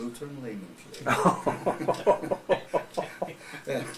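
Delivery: slow, drawn out through laughter; laughing. Real, not acted. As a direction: laugh as you say the punchline. Embarrassing punchline of his anecdote.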